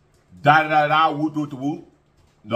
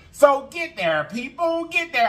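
high urging; 10 semitones higher, slower than usual. Real, not acted. Urging viewers.

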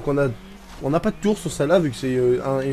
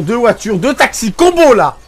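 rising higher and louder to a shout; sarcastic triumph; 6 semitones higher, 9 dB louder, slower than usual. Real, not acted. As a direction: build up to a mock victory cry on combo. Crashing into two cars.

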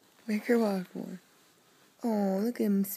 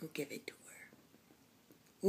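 slow hushed whisper; 5 semitones lower, 16 dB quieter, slower than usual. Real, not acted. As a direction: whisper the instruction slowly. Whispered instruction.

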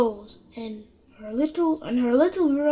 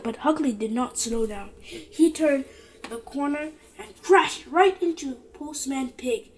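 plain reading voice

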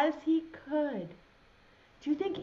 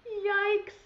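yikes; 8 semitones higher than usual. Acted. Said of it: "said Newton".

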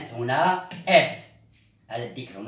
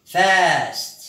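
teacher's drill call; 4 semitones higher, 6 dB louder than usual. Shown as a demonstration. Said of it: "Repeat with me".

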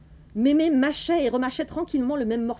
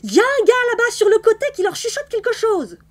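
much higher and louder, piercing; shrill loud cry; 11 semitones higher, 8 dB louder than usual. A narrator's reading. Granny loudly interrupting the play, a piercing high screech. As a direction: screech it across the theatre. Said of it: "d'une voix si criarde".

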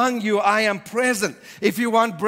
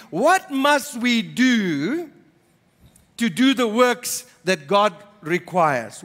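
slow, stretched first take; drawn-out question; much slower than usual. Acted. Pastor voicing the crowd's question, slow and stretched. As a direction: draw each word out slowly.

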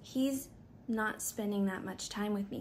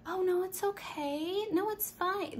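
much higher sweet brush-off; high reassuring cashier; 10 semitones higher than usual. Retold or imitated. Cashier reassuring the apologizing customer, high and sweet. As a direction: chirp it's fine to soothe her.

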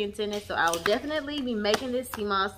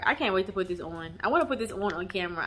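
usual speaking voice

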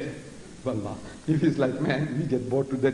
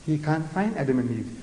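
ordinary delivery

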